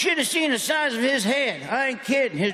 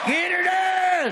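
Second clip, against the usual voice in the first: catchphrase held long and high; high drawn-out catchphrase; 8 semitones higher, slower than usual. Real, not acted. Comedian calls his catchphrase to the crowd, high and drawn out. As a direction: stretch it out way up high.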